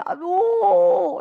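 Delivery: high loud put-on wail; mock wail. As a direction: let out a fake cry of distress. Mimics lazy man crying out. (rintihan pura-pura)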